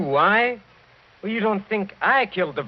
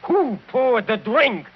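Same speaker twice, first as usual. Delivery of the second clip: heated accusation; 9 semitones higher, 4 dB louder than usual. Acted. Count lists her crimes heatedly.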